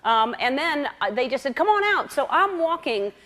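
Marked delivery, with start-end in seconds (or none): 1.6-2.1 high, light beckoning call; breezy retold invitation; imitated calling out. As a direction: imitate friends casually calling someone over. Friends calling her into the water.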